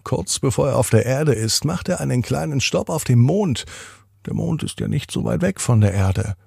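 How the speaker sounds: ordinary delivery, nothing marked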